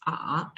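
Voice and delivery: AH dips a little, then rises; falling-rising tone. Shown as a demonstration. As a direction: let AH fall slightly and climb back. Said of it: "fall a little and then".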